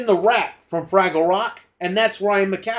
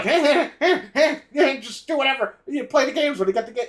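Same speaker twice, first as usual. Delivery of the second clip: higher, laughing, placating hey hey; mock easygoing Microsoft; 6 semitones higher than usual. Mocking Microsoft placating developers, laughing and higher. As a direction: brush it off with a laughing shrug. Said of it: "Microsoft is like".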